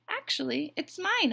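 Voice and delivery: high, loud goose correction; honking goose. A narrator's reading. Goose corrects Duck before the bluebird, honking. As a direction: honk out your rival claim. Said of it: "honked Goose".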